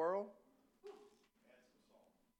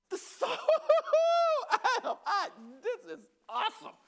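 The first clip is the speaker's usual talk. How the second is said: high burst of real laughter; overcome with laughter; 7 dB louder than usual. Real, not acted. Preacher laughing with joy in front of the church. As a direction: laugh from joy mid-sermon.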